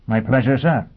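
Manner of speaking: high, crisp, distinct robot voice. Acted. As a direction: speak like a cheerful machine. Robot answering Foyle.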